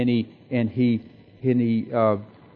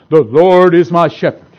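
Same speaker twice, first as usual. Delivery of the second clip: eloquent recitation; 11 dB louder than usual. Retold or imitated. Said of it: "said in such a special, more eloquent way".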